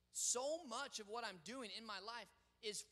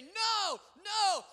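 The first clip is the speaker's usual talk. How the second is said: put-on childish refusal; 9 semitones higher, 12 dB louder than usual. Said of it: "we look at him and we say".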